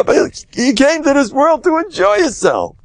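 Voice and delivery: rally the crowd to lighten up; real enthusiastic push; fired-up exhortation. Real, not acted. Exhorting listeners to enjoy life.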